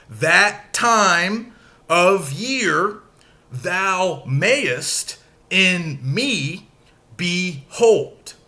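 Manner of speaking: slow, each second syllable hit; iambic stress. Shown as a demonstration. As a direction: scan it out, da-DUM da-DUM. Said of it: "I'm going from unstressed to stressed".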